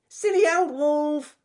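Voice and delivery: very high, slow rabbit yell; shouted taunt. Acted. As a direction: jeer loudly in a squeaky voice. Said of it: "shouted Ernest Fluffy Rabbit".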